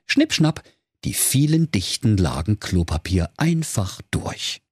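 usual speaking voice

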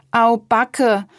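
calling out. A narrator's reading. Boy arriving after his kite crashed, calling out. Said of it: "rief Luis".

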